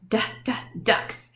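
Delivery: "Duh, duh, ducks" whispered, stressing the d; whispered letter sound. Shown as a demonstration. Teacher shows the d sound in ducks, whispered. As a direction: breathe out "Duh, duh" with a crisp d. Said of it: "They are ducks".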